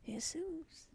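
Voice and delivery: playful Spanish hay-SOOS for "Jesus"; Spanish pronunciation. Pretend. Repeats the Spanish name as joke.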